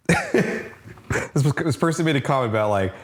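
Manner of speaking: laughing while telling it; giggling through the story. Real, not acted.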